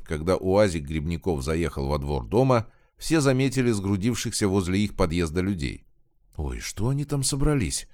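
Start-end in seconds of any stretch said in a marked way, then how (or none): 6.4-7.9 higher-pitched surprised exclamation. A narrator's reading. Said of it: "воскликнула Зинаида".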